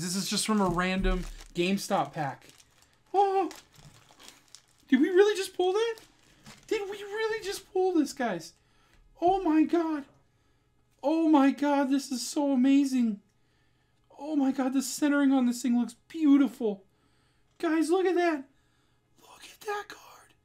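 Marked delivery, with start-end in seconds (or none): 3.1-18.4 high, loud, disbelieving squeals; stunned excitement. Real, not acted. Just pulled a top card. 19.2-20.3 slow, breath-only whisper of amazement; awed whisper. Real, not acted. Awed by the rare card.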